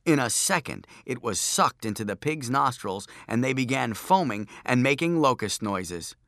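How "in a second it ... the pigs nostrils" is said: ordinary delivery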